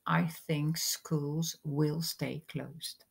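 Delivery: very slow, clear and steady; slow model sentence. Shown as a demonstration. Teacher modelling the English sentence for students, slow and clear. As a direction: spell it out slowly for learners. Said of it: "is then".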